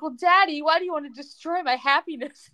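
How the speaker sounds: high, hammed-up soap heroine; melodramatic reproach. Voices Brooke's melodramatic reproach to Daddy.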